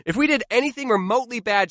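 ordinary talk, nothing marked

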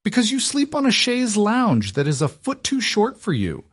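composure snapping into a shout; yelled. A narrator's reading. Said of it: "she yelled".

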